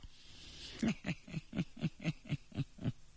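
breathy, knowing snicker; sly chuckle. Acted. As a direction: laugh under the breath, sly and amused. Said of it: "脸上是似笑非笑".

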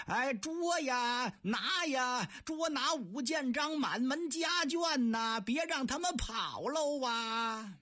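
high drawn-out bawling cry; bellowed shout. Acted. Soldiers bawling the arrest order at the gate, high drawn-out yell. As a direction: stretch every syllable like a town crier. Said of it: "扯着脖子就嚷上了".

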